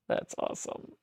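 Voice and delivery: whispered awe. Real, not acted. Reacting to a friend's good scan news, whispered awe. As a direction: hush it out in quiet delight.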